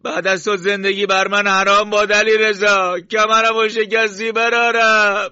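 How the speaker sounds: very high, drawn-out lament at full voice; screamed mourning that ends in a long cry; loud grieving wail. Acted. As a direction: wail in anguish, stretching the words.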